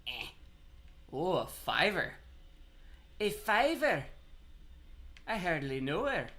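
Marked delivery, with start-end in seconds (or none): 3.2-6.3 hammed-up Scottish accent, pitched higher. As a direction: do the joke in a mock Scottish brogue. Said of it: "I don't know why a Scottish accent".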